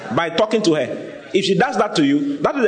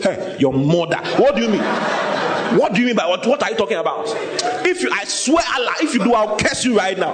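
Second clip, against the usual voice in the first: rough angry shouting; 6 semitones higher than usual. Acted. Peter denying Jesus to a girl, shouting angrily. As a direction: snap back at the accuser, swearing and yelling. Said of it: "Peter spoke roughly, harshly, cursed, shouted".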